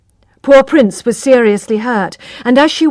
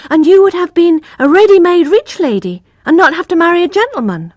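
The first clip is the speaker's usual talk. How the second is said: put-on higher, louder little-boy voice; eager child; 9 semitones higher, 6 dB louder than usual. Little brother teasing Tess about marrying, raised child voice. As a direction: push the point innocently.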